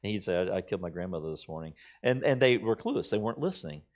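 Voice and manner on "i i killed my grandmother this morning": low, soft and fast. Retold or imitated. FDR repeating it to the guests, low and quick. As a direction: slip the line under your breath. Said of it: "in a low voice".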